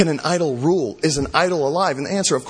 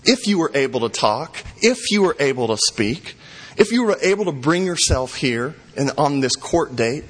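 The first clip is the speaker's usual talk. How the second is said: ordinary delivery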